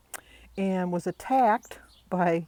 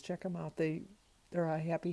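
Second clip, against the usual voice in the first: ordinary talk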